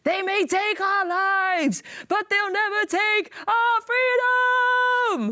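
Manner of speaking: high, drawn-out rallying shout; dramatic movie war cry. Acted. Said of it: "some sort of level of acting".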